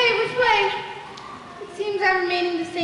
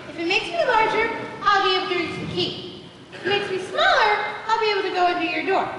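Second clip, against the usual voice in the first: fast; much faster than usual. Acted. Rushed reasoning aloud.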